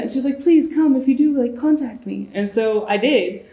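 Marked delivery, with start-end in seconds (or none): 0.4-2.3 eager pleading. Retold or imitated. Stranger's plea acted out.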